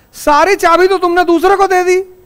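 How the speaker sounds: high, insistent rebuke; pointed reproach. Real, not acted. Teacher repeating the point about surrendered keys, high-pitched reproach. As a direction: drive the reproach home, pitched well up.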